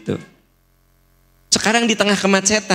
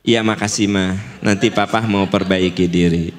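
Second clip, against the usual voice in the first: low, calm thanks to a wife; accepting husband; 11 semitones lower than usual. Shown as a demonstration. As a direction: give in gently, voice well down.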